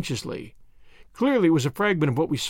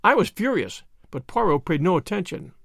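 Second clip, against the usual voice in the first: ordinary delivery, nothing marked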